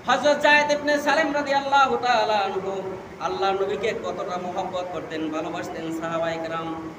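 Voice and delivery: usual speaking voice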